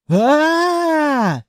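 drawn-out startled "aaah"; long open yell of alarm. A narrator's reading. Rider clinging to a bolting throne, startled yell. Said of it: "Я заорал от неожиданности".

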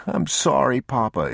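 put-on high child voice on the edge of tears; a boy starting to sob; boy apologizing to his father, starting to sob; sobbing boy. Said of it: "He was beginning to sob".

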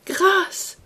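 high, loud plea for mercy; cried out. A narrator's reading. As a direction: cry out begging for mercy. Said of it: "cria Gwynplaine".

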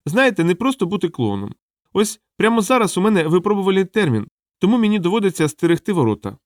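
high-pitched clown lamenting his lot; sorry small clown explaining himself; plaintive little clown. Acted.

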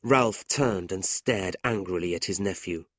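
ordinary delivery, nothing marked

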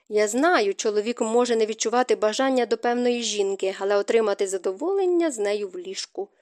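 usual speaking voice

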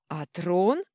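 loud barked roar of a tyrant king. Acted. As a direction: bark it out furiously. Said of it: "рявкнул король".

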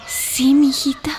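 whispering mother. Acted. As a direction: breathe it to your daughter. Mother answers softly.

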